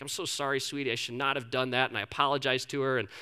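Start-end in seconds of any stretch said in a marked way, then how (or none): 0.0-1.9 soft, tender apology to a little daughter; softly soothing his child; gentle apology. Retold or imitated. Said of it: "I'm like".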